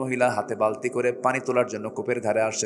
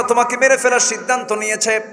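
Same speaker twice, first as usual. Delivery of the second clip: high, loud sermon cry; preacher's shout; 11 semitones higher, 7 dB louder than usual. Shown as a demonstration. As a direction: fling the line out like a preacher. Same line repeated in a shout.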